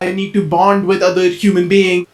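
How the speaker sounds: low, monotone robot voice; robotic impression. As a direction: intone it like a socially clueless robot. Mocks intentional social thinking as robotic.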